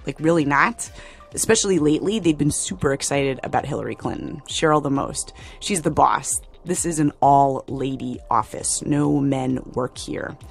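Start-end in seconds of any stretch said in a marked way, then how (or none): none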